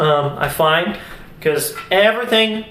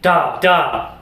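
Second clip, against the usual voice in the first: strong front on each da, then a little decay; accented da demo. Shown as a demonstration. Teacher showing students the accent with decay, accented syllables. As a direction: hit the start of each da and let it fade. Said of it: "you want to decay a little bit".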